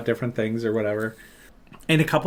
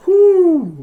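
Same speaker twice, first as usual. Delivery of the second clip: whoo; 12 dB louder than usual. Retold or imitated. Mocking hyper friend.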